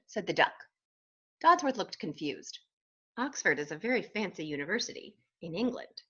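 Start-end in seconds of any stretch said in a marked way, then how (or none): none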